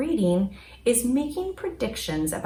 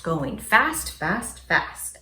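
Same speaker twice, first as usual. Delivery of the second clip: ordinary delivery, nothing marked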